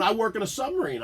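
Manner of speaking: ordinary talk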